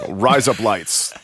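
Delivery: "rise up lights" run together as Australian "razor blades"; slow, put-on Australian accent. Shown as a demonstration. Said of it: "it sounds like an Australian accent saying razor blades".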